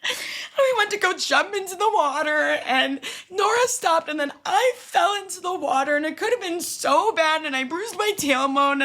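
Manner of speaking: high, wailing imitation of sobbing; crying. To the manager after falling in, mock crying. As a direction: blubber it out in a hammed-up high whine. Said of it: "I'm starting just crying, being like".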